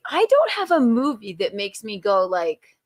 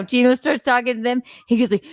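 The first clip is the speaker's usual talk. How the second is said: normal talking voice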